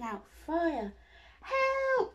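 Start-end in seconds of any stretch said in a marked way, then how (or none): 1.4-2.1 much higher, louder scream; cry for help. Acted. Witch chased by the dragon, frightened shriek. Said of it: "cried the witch".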